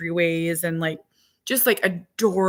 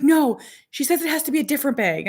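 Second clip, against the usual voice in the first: put-on insistent kid; 6 semitones higher, 3 dB louder, faster than usual. Son insists, voiced by mom.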